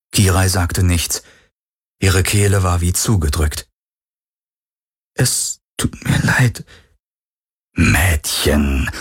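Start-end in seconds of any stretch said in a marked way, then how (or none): 5.1-6.6 tearful broken whisper; almost crying, barely voiced; choked whisper. Kehle zugedrückt, Entschuldigung. (erstickt geflüstert)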